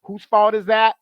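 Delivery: ordinary talk, nothing marked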